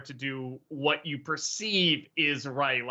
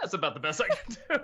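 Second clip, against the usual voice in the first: ordinary talk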